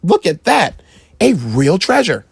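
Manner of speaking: high, astonished mom voice; a mother stunned by treasure; amazed mother. A narrator's reading. Mother seeing the chest, amazed voice.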